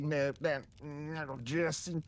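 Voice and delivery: lightly put-on Southern drawl on "little Jesse"; mild mock Southern accent. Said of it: "Southern maga country accent".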